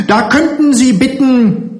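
very high, scornful refusal; höhnische Weigerung. A narrator's reading. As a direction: refuse with scorn, pitch shooting up. Matron defiantly refusing. (höhnisch abweisend, mit sehr hoher Stimme)

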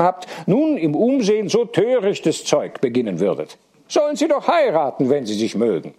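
high-pitched, animated character voice; high, lively mock indignation; Bürgi, teasing objector.

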